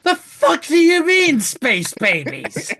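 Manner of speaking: loud, high-pitched incredulous outburst; mock outraged disbelief. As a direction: act out a viewer's shock at the credits. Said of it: "and you're like".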